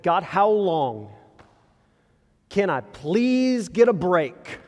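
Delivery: slow, drawn-out plea for a break; the author pleading with God how long, slow and weary. Retold or imitated. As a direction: beg God wearily, stretching each word.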